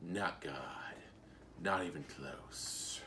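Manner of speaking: low, hushed, slow growl from the shadows; Batman. Retold or imitated. Batman answering the hostage taker from the shadows, low menace. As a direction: drop deep and quiet, drag it out menacingly. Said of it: "from the shadows, Batman's like".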